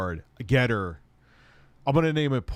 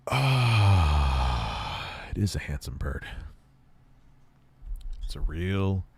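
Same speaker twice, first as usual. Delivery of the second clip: deep, put-on voice for the bird; low bird voice; 5 semitones lower, slower than usual. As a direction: speak as the bird, low and slow. Voicing the bird, low and far.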